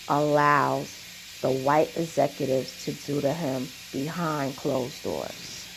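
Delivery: slow, low restatement with long pauses; measured emphasis, dropping low and quiet; deliberate repeat. Real, not acted. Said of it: "I wanna say that again".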